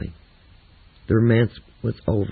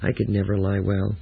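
plain reading voice

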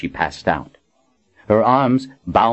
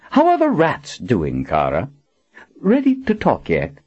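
mocking taunt; 7 semitones higher than usual. Villain taunts tortured captive.

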